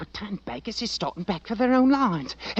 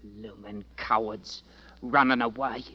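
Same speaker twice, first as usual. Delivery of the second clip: contemptuous mutter; 7 semitones lower, 3 dB quieter than usual. Acted. Drummer scorns fleeing regiment.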